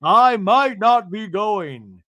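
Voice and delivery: sarcastic, loud, slow mimicry; [name] mocking Meredith. Castmate mocking Meredith's excuse, slow sarcastic voice. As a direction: draw it out high and mocking.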